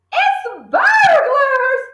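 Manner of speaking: high, slow scared hiss; frightened hiss. Acted. Mother fearing burglars, hissing to her husband. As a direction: hiss it in fear. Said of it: "hissed the mother".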